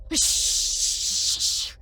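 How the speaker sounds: long, forceful shushing hiss; urgent hush. A narrator's reading. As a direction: hush them sharply. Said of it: "He hushed me".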